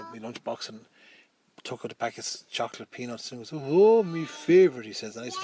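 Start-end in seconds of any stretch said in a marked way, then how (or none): 3.5-4.9 much louder and higher, greedy delight; gleeful bully. Retold or imitated. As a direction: crow over the stolen sweets. Bully grabs the chocolates.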